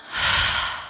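soft breathy exhale. Shown as a demonstration. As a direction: let out a slow breath. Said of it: "Let us begin to breathe with them".

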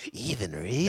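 imitating a creepy question; teasing creepy-voice echo; mock creeper. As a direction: mimic your friend sounding like a creeper. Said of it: "Why do you say it like a creeper?".

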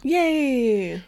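high, drawn-out little "yay"; mock cheer. Co-hosts cheering the hikers finding tracks, mock cheer. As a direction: cheer in a light sing-song, stretched out.

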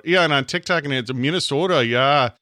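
loud, exaggerated Minnesota accent; Midwest accent. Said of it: "Midwest accent".

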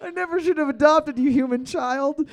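high mock-mother voice; scolding elephant mom. Host as elephant mom berates adopted child, high put-on voice. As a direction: pitch it up as a regretful, hammed-up mom.

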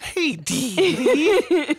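put-on high, loud cackling old woman; old crone voice. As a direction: play the crone greeting her customer. Voicing the old woman again.

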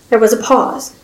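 plain reading voice

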